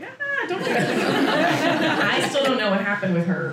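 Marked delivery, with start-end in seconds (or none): 0.0-2.0 laughing plea. Retold or imitated. Pleading with woman.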